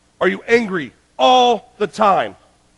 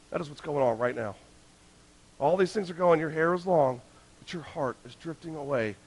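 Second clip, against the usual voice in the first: quiet intimate appeal; 7 dB quieter than usual. Real, not acted. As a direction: lean in and speak softly to the heart. Softened, intimate preaching.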